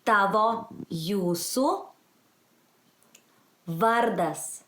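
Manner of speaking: slow, word by word with long pauses; repeat-after-me model. Shown as a demonstration. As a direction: lift each word, then let vardas fall. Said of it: "repeat it after me".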